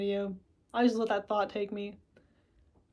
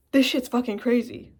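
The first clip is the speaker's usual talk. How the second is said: mock panic; 3 semitones higher, 7 dB louder than usual. Acting out panicked self-talk.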